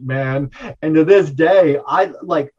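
usual speaking voice

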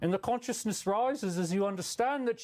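a light, loose Irish-Australian bloke; vaguely Irish-Australian accent. Acted. Streamer rambling about love and light in a mixed accent. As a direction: play a stoned, mellow Aussie-Irish guru. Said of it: "say words in a vaguely Irish accent".

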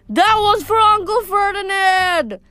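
high, drawn-out battle yell; defiant yell. A narrator's reading. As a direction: yell vengeance back at the monster. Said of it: "Grover yelled back".

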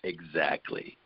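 deep hushed "Exactly"; low, soft agreement. Retold or imitated. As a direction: answer low and quiet, knowingly. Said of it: "And then he goes".

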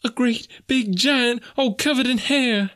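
very high, slow falsetto wail; frightened maid. A narrator's reading. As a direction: describe the monster in dread. Maid describes the horrible abductor.

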